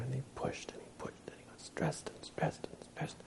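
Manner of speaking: hushed, breathless telling of the collapse; whispered narration. A narrator's reading. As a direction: drop to a whisper as he breaks down. Narrating the monk's exhaustion.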